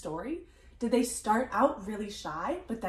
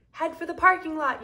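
louder, higher read-aloud yell; raised storybook shout; 7 semitones higher, 7 dB louder than usual. A narrator's reading. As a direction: call the order out to the gang. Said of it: "yelled one of the big boys".

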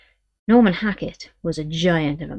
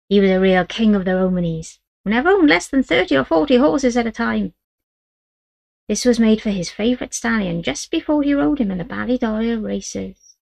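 put-on proud old woman; 4 semitones higher, 3 dB louder than usual. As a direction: she boasts of her husband, king of the Romanies. Great-grandmother voiced.

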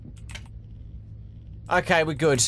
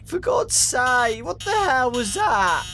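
ordinary delivery

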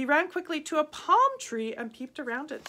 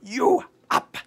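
a touch of a menacing tiger; tiger threat; 8 dB louder than usual. A narrator's reading. Tiger telling the boy he will eat him, mild. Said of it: "it said to him".

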